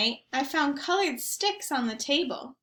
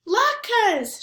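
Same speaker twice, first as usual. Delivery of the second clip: high, loud excited shout; 10 semitones higher, 6 dB louder than usual. A narrator's reading. Zoe shouting about the latkes, excited high shout. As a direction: call it out bright and high. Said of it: "shouted Zoe".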